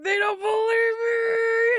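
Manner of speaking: high, drawn-out loud wail; retold wail. Patient retells her panic at not being believed, wailing. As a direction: cry it out, stretching every word. Acting out her distressed outcry.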